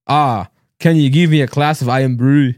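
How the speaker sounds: slow, careful Scottish accent; amateur Scottish accent. Shown as a demonstration. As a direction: attempt a Scottish accent, drawing it out. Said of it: "do a Scottish accent".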